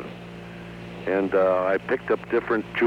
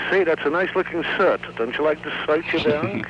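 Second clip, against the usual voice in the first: high-pitched Litvak who says "shirt" as "sirt"; high Yiddish dialect voice, lisp-free S in place of SH in "shirt"; Litvak accent, SH as S; 7 semitones higher than usual. Shown as a demonstration. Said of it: "who can't pronounce, uh, S's, uh, S H".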